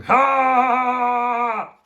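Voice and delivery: long, high, loud "ha"; mock laugh. A narrator's reading. Everyone at the party laughing, loud mock laugh. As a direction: belt out one drawn-out fake laugh. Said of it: "suddenly everyone was laughing".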